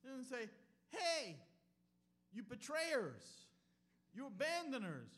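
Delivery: loud, high mock calls; preaching at full force. Preacher contrasting what Jesus didn't call them, loud and high. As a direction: fling out the insults Jesus never said. Preacher listing rejected greetings.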